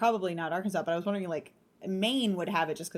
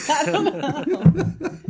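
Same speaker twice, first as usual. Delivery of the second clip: normal talking voice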